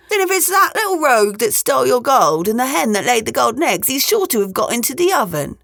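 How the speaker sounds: high, lilting character voice; ogre's wife. A narrator's reading. Wife sending ogre to search the oven, high lilting voice. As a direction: chatter on in a high wifely voice. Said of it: "said the ogre's wife".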